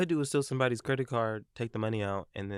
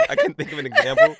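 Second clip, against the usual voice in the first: laughing; 10 dB louder than usual. Real, not acted. Laughing confession.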